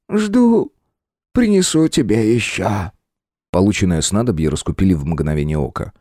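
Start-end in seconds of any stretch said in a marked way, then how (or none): 0.1-2.9 slow, high, eerie hungry spirit. Acted. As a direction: savor each word greedily. Well spirit replies.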